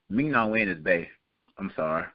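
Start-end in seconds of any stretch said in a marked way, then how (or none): none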